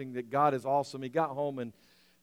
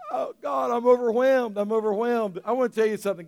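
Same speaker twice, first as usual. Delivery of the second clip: overwhelmed crying; 8 semitones higher, 7 dB louder than usual. Retold or imitated. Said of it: "he begins to cry and weep".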